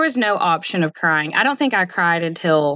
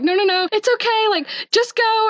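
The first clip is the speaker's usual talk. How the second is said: higher, hurried reassurance from coworkers; flustered coworkers; 13 semitones higher than usual. Retold or imitated. Coworkers rushing her out after bad news, high and flustered. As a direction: urge her out the door, pitched high.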